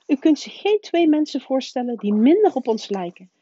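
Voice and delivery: the strict witch protesting the Dursleys; indignant professor. A narrator's reading. McGonagall protesting.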